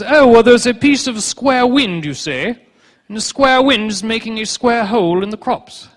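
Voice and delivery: high, loud, slow mock-learned professor voice; a high, drawn-out parody of a stuffy 1600s expert; pompous old scholar. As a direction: lecture like a self-important old scientist. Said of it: "Plot's educated hypothesis was".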